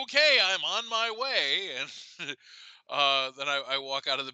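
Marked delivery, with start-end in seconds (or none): 0.0-1.7 high, slow sing-song; sing-song compliance. Retold or imitated. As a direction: answer sweetly, playing dumb.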